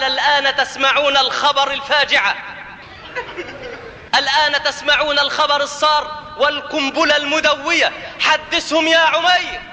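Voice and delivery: high, ringing announcement to a crowd; proclamation. Acted. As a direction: proclaim it grandly, building to a shouted command. Safwan proclaims the news to Quraysh. (إعلان خطابي رنان بنبرة عالية)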